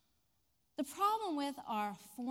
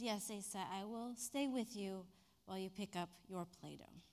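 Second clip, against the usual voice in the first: soft fading tender reply; quiet and kind; gentle; 5 semitones lower, 8 dB quieter than usual. Retold or imitated. Teacher promises to stay, gentle.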